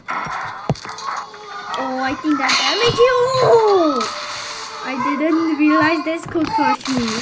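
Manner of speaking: high, loud startled shout; surprised. Real, not acted. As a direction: cry out in shock. Shock at being crushed.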